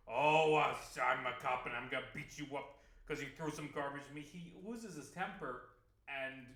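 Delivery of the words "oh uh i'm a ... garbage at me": loud burst of a hot-tempered hero; mock angry cop. Hal yelling at the man who threw garbage, loud and hammed up. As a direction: yell it like a cop losing his temper. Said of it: "but he's like".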